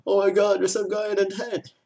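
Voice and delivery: high, loud mock alarm; imitated panicking camper. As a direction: put on a high-pitched freaked-out camper. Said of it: "you hear some guy saying".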